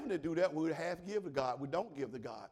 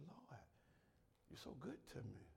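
soft prayer; 3 semitones lower, 18 dB quieter than usual. Acted. Preacher softly confides a prayer.

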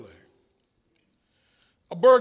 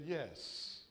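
soft parrot voice; 8 semitones lower, 20 dB quieter than usual. A narrator's reading. Parrot answers the burglar.

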